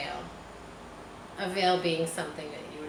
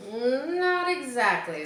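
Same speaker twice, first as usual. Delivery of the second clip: slow, drawn out and higher; hesitant; 7 semitones higher, 4 dB louder, slower than usual. A narrator's reading. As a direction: hold back, unsure how to say it. Farmhand hesitant reply.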